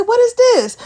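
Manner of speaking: loud, high, indignant demand; outraged question. Retold or imitated. To the bartender about the salted rim, loud indignant. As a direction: call her out sharply across the bar.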